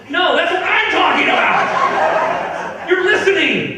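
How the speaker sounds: high, loud, slow mock outburst; hammed-up yelling, laughing; mock shout. Comic mocking the interrupting guy with a hammed-up shout.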